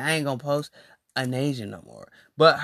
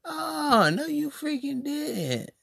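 sassy disbelief; 10 semitones higher, 4 dB quieter, slower than usual. Retold or imitated. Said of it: "And I'm sitting there like".

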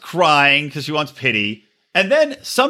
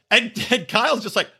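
ordinary delivery

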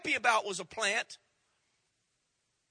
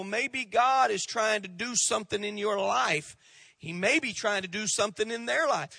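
ordinary talk

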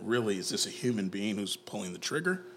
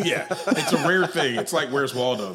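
loud, raised voice through laughter; laughing; 5 semitones higher, 9 dB louder than usual. Real, not acted. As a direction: joke while cracking up. Joking about rare RoboCop non-viewer.

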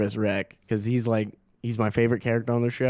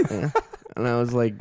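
normal talking voice

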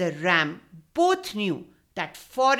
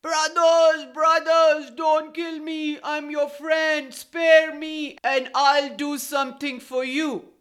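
higher, louder panicked begging for his life; pleading fox; 5 semitones higher, 5 dB louder than usual. A narrator's reading. Terrified fox begs goat and ram, wild pleading. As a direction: cry out wildly, begging to be spared. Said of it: "cried out wildly".

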